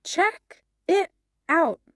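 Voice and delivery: three separate words, CHECK, IT, OUT, with gaps; over-separate each word, high and unnatural; slow and choppy. Shown as a demonstration. Co-host showing learners the unlinked phrase, slow and choppy. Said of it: "It sounds very slow and choppy".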